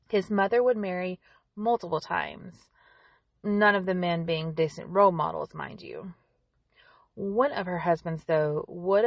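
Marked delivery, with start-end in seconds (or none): 5.5-6.1 soft unvoiced aside; whispered aside. Real, not acted. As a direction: drop to a whisper for this.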